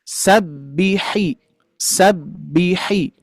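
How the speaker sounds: sabbihi drawn out very slowly, each sound clear; sabbihi, the h clearly heard. Shown as a demonstration. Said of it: "harus betul-betul diperjelas".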